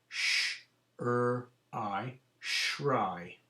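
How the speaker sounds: Sh-er-I, then blended shry; sounding out shry. Shown as a demonstration. Teacher to class, sounding out shry. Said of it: "sounding out nonsense word".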